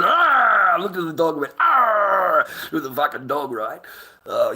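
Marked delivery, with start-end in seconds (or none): 1.6-2.5 a loud, rough growl at the dog; mock growl. Growling back at the dog that growled at him. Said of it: "You wanna growl at me?".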